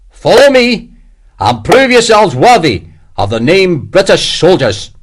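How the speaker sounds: high, loud Scottish battle cry; broad Scottish shout. A narrator's reading. Commander leading his men over the trench, shouting in Scottish. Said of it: "shouted in his broad Scottish accent".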